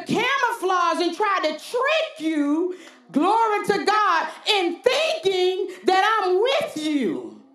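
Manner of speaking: rising, fervent preacher's cadence; preaching. Acted. Preacher stirring the congregation to a fervent peak. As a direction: preach it out with building fire.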